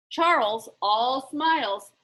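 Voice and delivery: ordinary delivery, nothing marked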